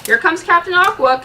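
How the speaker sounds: loud high heroic call; loud eager announcement; shouted. Acted. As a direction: shout it as you run. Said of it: "Captain America shouted".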